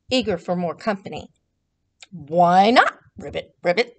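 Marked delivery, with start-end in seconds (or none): 2.1-3.9 low croaky frog voice. Acted. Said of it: "answered the frogs".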